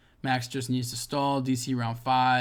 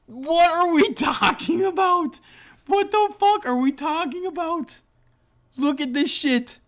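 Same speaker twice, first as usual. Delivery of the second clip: over-the-top outraged rant; incredulous shout; 7 dB louder than usual. Acted. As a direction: shriek it in outraged disbelief. Ranting over the main card.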